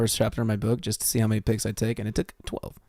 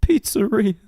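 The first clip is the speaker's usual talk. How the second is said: high, loud mock Italian accent; 12 semitones higher, 7 dB louder, slower than usual. Joker defending Italians, in a high mock Italian accent. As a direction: exclaim in a hammed-up Italian accent. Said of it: "if disrespect Italians again".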